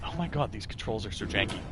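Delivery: ordinary talk, nothing marked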